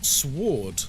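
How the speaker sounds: "Sword" with the W sounded, SWORD; pronounced W. Retold or imitated. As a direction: say "Sword" as s-word, W audible. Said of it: "pronounce the W in sword".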